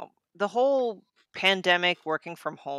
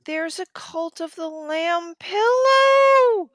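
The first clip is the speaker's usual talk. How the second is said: high excited shout, slow; 12 semitones higher, 6 dB louder, slower than usual. Real, not acted. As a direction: squeal with delight. Excited about merch find.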